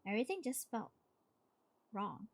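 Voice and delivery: plain reading voice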